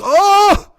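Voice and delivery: put-on high, loud sudden old man's Oh; old deli man suddenly remembering something, high loud Oh; startled cry. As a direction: yelp as if you just remembered. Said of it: "he goes".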